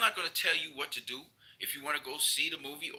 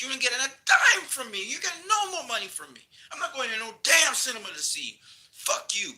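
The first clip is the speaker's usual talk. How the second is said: much higher and louder, yelling; real angry outburst; furious shout; 9 semitones higher, 5 dB louder than usual. Real, not acted. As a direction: blast it at the studio. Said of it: "I am so mad right now".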